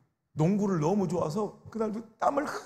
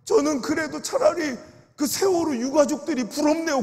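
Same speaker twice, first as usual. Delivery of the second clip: put-on tearful old elder; 6 semitones higher, 4 dB louder than usual. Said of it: "막 울면서".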